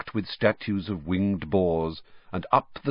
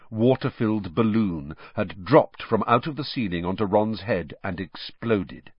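plain reading voice

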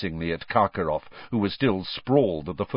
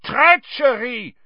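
high, loud drawn-out bellow; outraged, high-pitched roar; 7 dB louder, slower than usual. A narrator's reading. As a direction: bellow the accusation slowly. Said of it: "he bellowed".

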